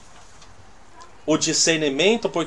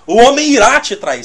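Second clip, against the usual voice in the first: louder, higher proclaimed "o homem irá te trair"; mock bold prophecy; 5 semitones higher, 6 dB louder than usual. Preacher mocking someone declaring betrayal, loud. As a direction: announce it boldly like a prophet. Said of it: "alguém vai e diz assim".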